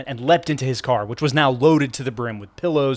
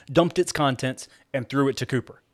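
ordinary delivery, nothing marked

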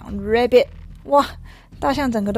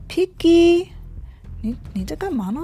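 "Piggy" called out higher; surprised elephant; 6 semitones higher than usual. Acted. Elephant startled, calling out to hopping Piggy. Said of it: "大象就说".

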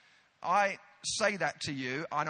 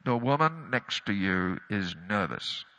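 lower, pointed aside from a pushy friend; evangelist friend; 9 semitones lower, 3 dB louder than usual. Retold or imitated. Evangelist friend pointing out the nervous passenger to the preacher, lightly mimicked.

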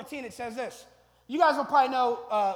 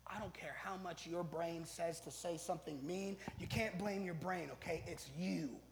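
hushed fatherly warning, softer; a quiet stern word to his daughter; whispered scolding; 5 semitones lower, 11 dB quieter than usual. Retold or imitated. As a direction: lean in and whisper it firmly. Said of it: "And I said".

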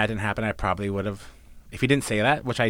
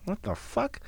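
pitched-up stunned what; shocked disbelief; 12 semitones higher, 8 dB quieter, slower than usual. Retold or imitated. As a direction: caught off guard, incredulous. Retold shocked reaction, high pitch.

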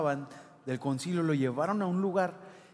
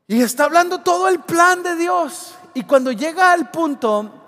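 high, loud preaching cry; preaching climax; 9 semitones higher, 9 dB louder than usual. Real, not acted. Pastor building to a climax in his sermon. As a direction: lift it up to the congregation.

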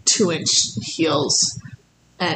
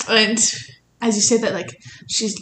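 ordinary talk, nothing marked

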